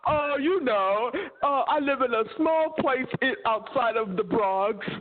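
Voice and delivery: mock the guy claiming he's American; imitating a fake American. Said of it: "Not with that accent".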